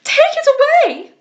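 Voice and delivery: very high, loud, slow panicked shriek; shrill frightened scream. A narrator's reading. Lady Louisa shrieking at the monkey, very high and loud. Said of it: "her fright and screaming rendered extremely shrill".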